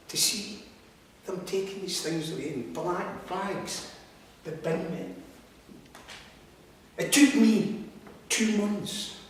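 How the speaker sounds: ordinary talk, nothing marked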